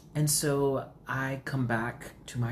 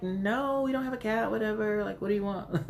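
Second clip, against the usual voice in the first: higher-pitched mock neighbour brush-off; dismissive neighbour; 9 semitones higher than usual. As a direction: imitate a neighbour brushing someone off. Wary neighbours behind closed door, retold.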